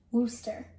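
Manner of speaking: Worcester as WUSS-ter; two syllables only in Worcester; local pronunciation. Shown as a demonstration. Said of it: "What about this second largest city".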